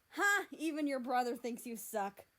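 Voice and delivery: loud, high mocking laugh and taunt; gloating jeer. Mexico jeering at America, loud and gloating. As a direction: crow over him triumphantly.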